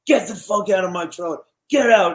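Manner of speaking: imitated angry order, pitched high; retold anger. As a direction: act out someone furiously throwing a person out. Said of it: "he was so mad at me".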